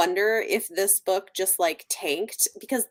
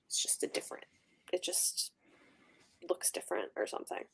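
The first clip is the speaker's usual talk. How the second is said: whisper; 2 semitones lower, 14 dB quieter, faster than usual. Real, not acted. Trailing speculation.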